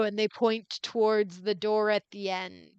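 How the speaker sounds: ordinary talk, nothing marked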